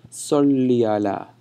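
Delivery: "Salli ala" run together, slow and stretched; connected vowels. Shown as a demonstration. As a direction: glide from the i of Salli straight into ala. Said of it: "A and ala together".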